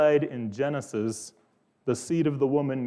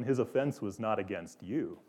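calm lion; 9 dB quieter than usual. Acted. Calm Aslan answers the witch.

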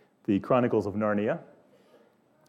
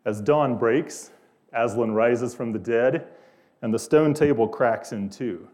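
ordinary talk

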